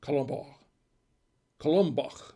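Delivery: Colombar said with a full French accent; Colombar, Colombach in a hammed-up French accent. Shown as a demonstration. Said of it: "if I do it with a full French accent".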